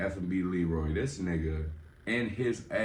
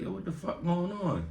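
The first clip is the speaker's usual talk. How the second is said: high, confused yelp; mock panicked question; confused alarm; 10 semitones higher than usual. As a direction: burst in, alarmed. Runs in confused.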